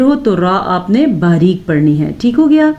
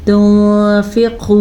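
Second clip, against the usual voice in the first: slow melodic tajweed chant; Quran recitation; much slower than usual. Shown as a demonstration. Teacher demonstrates the verse for students, slow chant. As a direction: draw each word out in a chant.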